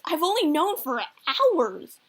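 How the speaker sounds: high, loud, drawn-out protest; acted indignant surprise. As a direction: stretch it out in mock outrage. Son shocked at father's knowing.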